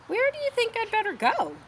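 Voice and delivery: a little piglet asking for help; high, unsure piglet voice; small pig voice. Acted. Wilbur asks goose.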